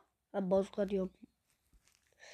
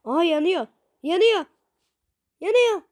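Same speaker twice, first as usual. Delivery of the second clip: rising excitement; 7 semitones higher, 4 dB louder than usual. Real, not acted. Target catching fire in game.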